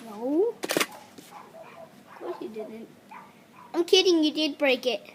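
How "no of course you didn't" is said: slow unvoiced sarcastic whisper. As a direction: tease it in a breathy whisper. Teasing before admitting.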